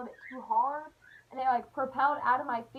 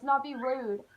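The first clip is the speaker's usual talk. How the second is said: ordinary talk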